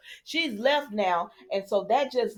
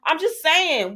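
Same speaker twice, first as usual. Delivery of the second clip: ordinary talk, nothing marked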